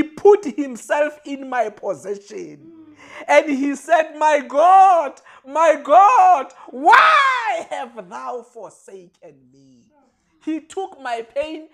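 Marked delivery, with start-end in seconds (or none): 4.2-9.8 anguished shout that slows at the end; loud anguished cry to God. Retold or imitated. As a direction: cry out, slower on the last line. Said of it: "he cried out to God".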